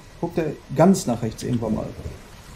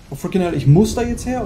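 louder, higher burst of frustration; frustrated outburst; 6 semitones higher, 6 dB louder than usual. Real, not acted. Gamer cursing the game, exasperated outburst.

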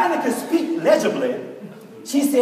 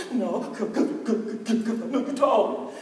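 much softer, breathy stutter through tears; whimpered sob; 7 dB quieter than usual. Retold or imitated. Crying girl reassuring her teacher, whimpered sobbing stutter. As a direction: barely get the words out past the crying. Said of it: "Stop crying for just a minute".

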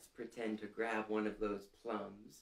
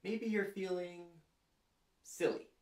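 high, goofy sing-song; playful high silly voice; 8 semitones higher than usual. Acted. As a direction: say it like a silly face. Naming silly feeling to kids.

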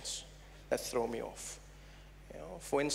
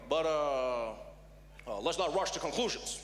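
hesitant presidential impression with pauses; Barack Obama; 3 semitones lower than usual. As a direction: back down carefully, stalling like a president. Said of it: "Obama was like".

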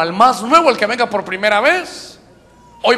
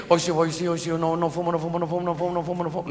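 soft, monotone repetitive muttering; mock self-persuasion mantra; 7 dB quieter, faster than usual. Preacher mocking a smoker willing himself to quit, muttered chant. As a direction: chant it under the breath on one note.

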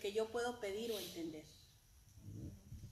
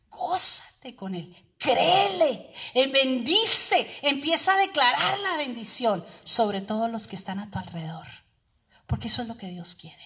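preaching at full force; 18 dB louder than usual. Acted. Preacher urging the congregation, at full force. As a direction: pour it out like a revival preacher.

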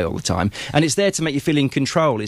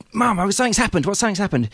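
lightly voiced, higher and eager; a hint of a breathless son blurting news; John telling his mum; 8 semitones higher, faster than usual. John on his mum's doorstep, mildly raised and eager. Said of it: "And he goes".

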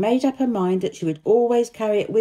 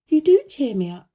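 put-on high, louder girl's voice; little girl from India; 7 semitones higher, 5 dB louder than usual. A narrator's reading. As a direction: tell it earnestly in a pitched-up girl's voice. Narrator voices Mary.

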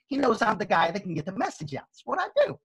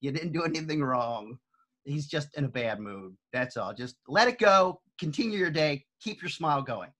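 lower, softer soothing advice; mild, reassuring mentor voice; calm reassurance; 6 semitones lower, 4 dB quieter than usual. Retold or imitated. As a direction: settle someone down gently. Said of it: "Phil said".

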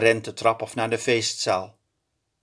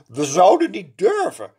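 higher, louder servant voice; a nervous lakei protests; 8 semitones higher, 6 dB louder than usual. A narrator's reading. Lakei answers the angry princess. (lakei verdedigt zich)

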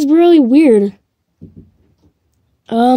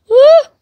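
short, high, loud yelp; startled scream; 12 semitones higher, 5 dB louder than usual. Real, not acted. Player caught by a chasing attacker, startled scream. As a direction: yelp as if jumped on.

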